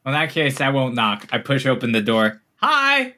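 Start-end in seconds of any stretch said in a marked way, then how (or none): 2.6-3.1 high bright shout of hi; loud cheery greeting. Acted. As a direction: burst out with a sudden greeting. GM's creature greets party loudly.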